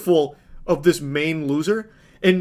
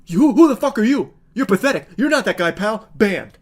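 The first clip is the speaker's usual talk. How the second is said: mock furious rant; 4 semitones higher, 4 dB louder than usual. Mocking the angry streamer.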